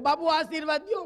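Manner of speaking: asking the naked sadhu again for blessing, pleading. Retold or imitated. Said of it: "મેં કીધું".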